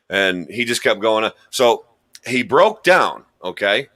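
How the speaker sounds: ordinary delivery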